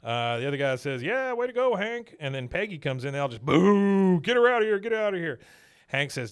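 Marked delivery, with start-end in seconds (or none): 1.0-2.2 high hearty cheer; a diner regular cheering Hank, high and bright; excited cheer. Retold or imitated. 3.4-5.4 loud, high jeering crowd; café crowd jeering Peggy, loud and high; mock booing.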